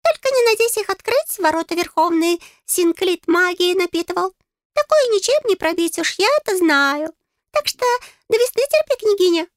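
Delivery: high, piping little voice; tiny fairy laying down the facts; know-it-all fairy. A narrator's reading. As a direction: warn her in a squeaky voice. Fairy Gustav speaks.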